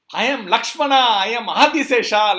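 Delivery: very loud, high self-proclamation; acted roar of divine identity, swelling on the second name; booming boast. Balram answers Yashoda's challenge, loud boastful proclamation.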